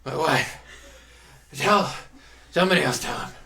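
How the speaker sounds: put-on loud, high, panting old sailor; out of breath old man. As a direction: gasp it out, winded and loud. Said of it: "I'm out of breath from doing that".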